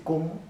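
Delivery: "cómo" high, punched and rising; enfático. Shown as a demonstration. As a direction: throw "cómo" up like an emphatic question. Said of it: "estoy siendo enfático". («cómo» enfático, alto y ascendente)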